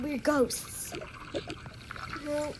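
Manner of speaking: ordinary delivery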